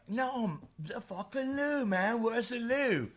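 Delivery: high, slow mock English accent; exasperated English accent. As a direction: imitate an Englishman losing patience. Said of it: "he kept asking me".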